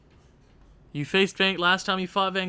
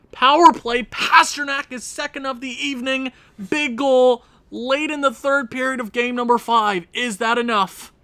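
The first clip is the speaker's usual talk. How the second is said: higher, louder hockey announcer call; play-by-play call; 8 semitones higher, 6 dB louder than usual. Acted. Gamer calling his sim game like a broadcaster.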